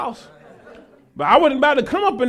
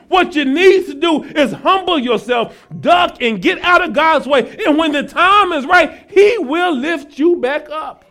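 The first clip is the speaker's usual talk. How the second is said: raised, driving sermon delivery with hammered key phrases; full-force preaching; 5 semitones higher, 3 dB louder than usual. Real, not acted. Preacher telling congregation to get out of God's way, forceful preaching. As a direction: press the congregation hard, pitched up.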